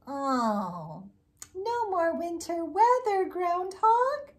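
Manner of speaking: mock plea. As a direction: beg the groundhog playfully. Pleading with groundhog.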